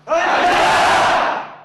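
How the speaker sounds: loud drill-sergeant response, drawn out; shouted reply. Real, not acted. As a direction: bellow it like a recruit at attention. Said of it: "목소리 이거밖에 안 나오나?".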